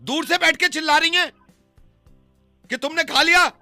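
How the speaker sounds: loud, high-pitched protest; exasperated, shouted denial; indignant shouting. Real, not acted. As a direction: protest at the top of the voice. Indignantly denies knowing the woman.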